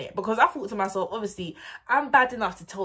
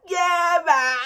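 loud, high "Yeah, man"; Young Finny impression; 11 semitones higher, 9 dB louder than usual. Quoting Young Finny's catchphrase, high and loud. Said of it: "how Young Finny says".

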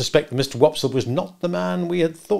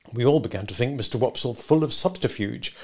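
usual speaking voice

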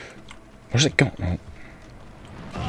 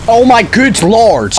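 high, drawn-out exasperation; slow, high outburst; exasperated cry; 11 semitones higher, 4 dB louder, slower than usual. Real, not acted. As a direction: stretch out a high cry of frustration. Frustrated at the game.

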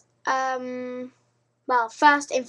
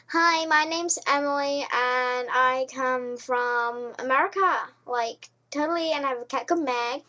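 bad American accent. Shown as a demonstration. British girl introducing herself in a badly faked American accent. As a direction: attempt American without really managing it. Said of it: "I can't do American".

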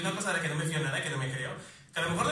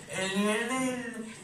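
drawn-out, higher newsreader voice; news report parody; 4 semitones higher, slower than usual. Retold or imitated. Comedian mimicking a climate change news item, newsreader parody. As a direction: announce it like an alarming headline, slowly. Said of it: "la noticia, en plan de".

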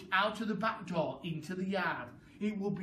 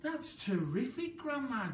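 put-on boyish, higher excited cry; delighted boy praising his grandma; George crying out; 7 semitones higher than usual. A narrator's reading. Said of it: "George cried".

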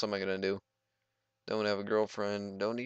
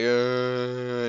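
mock skydiving grunt; 4 semitones higher, 6 dB louder than usual. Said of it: "You'd be like".

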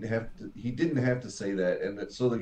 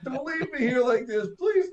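high, whining please; whiny plea; 11 semitones higher than usual. Retold or imitated. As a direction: beg in a high whine. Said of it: "He's like".

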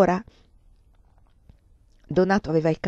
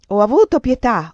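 loud, raised, indignant retort; angry yell; 4 semitones higher, 8 dB louder than usual. A narrator's reading. Lover outraged by the plea for pity, angry yell. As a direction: throw her plea back at her. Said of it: "urlò".

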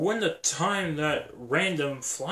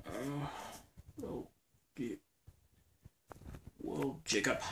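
ordinary talk, nothing marked

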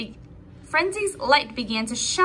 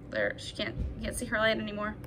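hushed aside; 4 semitones lower, 9 dB quieter, faster than usual. Real, not acted. Hushed aside about hidden firefly.

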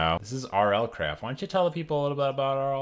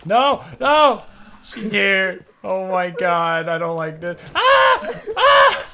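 being chased; 8 semitones higher, 8 dB louder, much slower than usual. Real, not acted. Fleeing a ghost in game.

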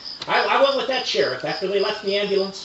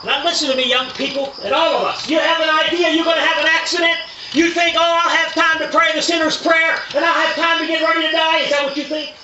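high, loud pulpit preaching; fervent revival preaching, higher and louder; preaching at full force; 9 semitones higher, 4 dB louder than usual. Acted. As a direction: drive it home to the congregation. Preacher warns the young people.

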